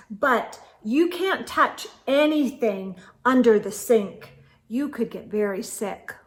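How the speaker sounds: ordinary delivery, nothing marked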